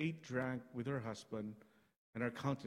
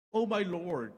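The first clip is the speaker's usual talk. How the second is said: raised, higher plea; earnest cry; 6 semitones higher, 7 dB louder than usual. Acted. Mother Hannah greeting the priest, raised plea. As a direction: call out to him with feeling. Hannah addresses Eli in reading.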